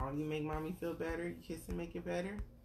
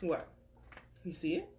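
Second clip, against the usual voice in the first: ordinary delivery, nothing marked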